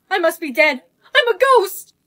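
loud cry, climbing high; alarmed realization. Acted. Girl deciding she is a ghost, alarmed. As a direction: build to a high, loud exclamation.

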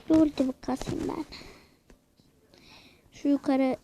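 ordinary delivery

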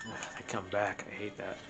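ordinary delivery

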